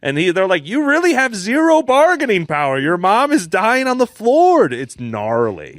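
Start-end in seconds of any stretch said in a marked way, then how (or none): 0.7-4.7 high, loud imitation of cops; mock police shout, pitched way up; urgent shouting. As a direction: yell it across a standoff. Acting cops shouting at the gunman.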